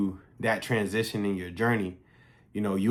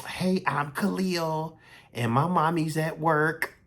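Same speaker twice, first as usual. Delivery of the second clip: put-on higher, slow little girl's voice; 7 semitones higher, slower than usual. Daughter telling the store worker, slow and high little-girl voice. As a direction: play his small daughter, slow and sweet.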